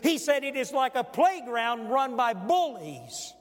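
loud, high pulpit reading; full-force preaching. Acted. Preacher reading Swindoll's quote again to the congregation, full-force preaching.

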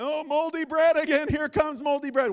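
high, loud mock groan over the bread; mock dread. Preacher to congregation, playing a dreading worrier, loud and high. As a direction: whine about moldy bread in a hammed-up wail. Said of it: "walk in the light".

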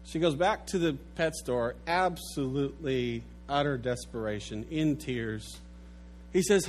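normal talking voice

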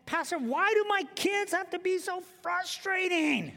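high, whining complaint; whiny parent. Retold or imitated. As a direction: moan it like a worn-out parent. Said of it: "Some of you are saying".

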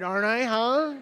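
normal talking voice

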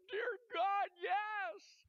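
very high, loud tearful cry; retold sobbing shout to heaven. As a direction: cry it out at the top of the voice. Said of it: "I start to cry".

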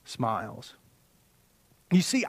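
normal talking voice

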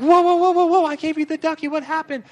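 high, alarmed whoa from a flustered parent; startled parent. Retold or imitated. Parent startled by screaming child.

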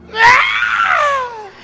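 put-on high, loud wailing scream of a toddler; child scream. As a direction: shriek like a hurt toddler. Child screaming after the fall.

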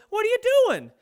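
high, loud indignant challenge; mad dad. Retold or imitated. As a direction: catch the kid in the act. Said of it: "and I go".

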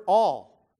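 "all" said loud on its own; stress on all. Real, not acted. Preacher naming the word he emphasized, loud stress on "all". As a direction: land hard on the single word "all". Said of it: "I was emphasizing that word".

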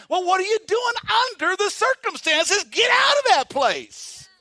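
high, loud mock shout; retold shout. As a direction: bark it at him to wake him up. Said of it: "And the guy responded to him".

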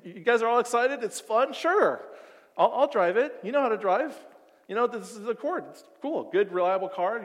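giddy friend about to drive the new car; excited. Retold or imitated. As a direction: bubble over with excitement.

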